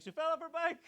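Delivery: high, soft, innocent little excuse; rehearsed excuse. Retold or imitated. Dad rehearsing his story for the nurses, high and soft. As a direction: recite it like a practised alibi.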